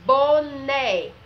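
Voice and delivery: BONÉ stretched, high and very loud, final É wide open; open É sound. Shown as a demonstration. Teacher stressing the open sound to pupils, loud drawn-out BONÉ with open É. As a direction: belt out BONÉ to show its strong open vowel. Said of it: "ela fica com o som aberto".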